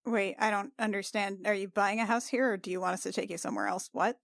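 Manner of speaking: normal talking voice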